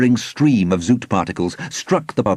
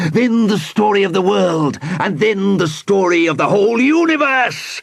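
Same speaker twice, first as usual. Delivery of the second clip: high, grandiose villain declamation; shouting villain; 6 semitones higher than usual. A narrator's reading. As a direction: build to a megalomaniac's cry of triumph. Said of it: "he shouted".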